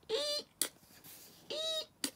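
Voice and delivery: very high, soft squeal of fright; squeaky frightened eek. Acted. Little monster spotting the ogre's huge feet, tiny high squeak.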